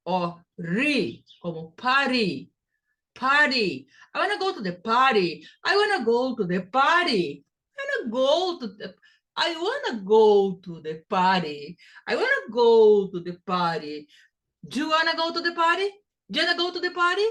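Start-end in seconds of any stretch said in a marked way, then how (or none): 4.1-14.1 "party" said as "pari" with a flapped T, fast; American flap T. Shown as a demonstration. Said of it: "T in American English". 14.7-17.3 "party" with a flapped T, fast and much higher; fast American question. Shown as a demonstration. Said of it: "T in American English".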